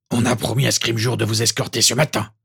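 dry, lightly altered character voice; curt Maugré. A narrator's reading. Auror informs the ex-professor of his escort, curt. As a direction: state the order drily, brooking no refusal. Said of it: "signifia sèchement Maugré".

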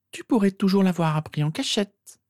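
put-on high-pitched girl's voice; objecting sister. A narrator's reading. Sister countering Harry's proposed proof, objecting. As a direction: counter him in a high, doubting voice. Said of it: "opposa-t-elle".

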